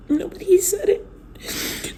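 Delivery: high, slow, broken through tears; crying it out; sobbing. Real, not acted. To her viewers, slow and in tears.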